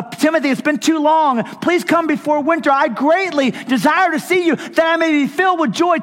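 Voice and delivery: high, loud impassioned sermon; preaching at full force. Acted. Preacher pleading his love to the congregation.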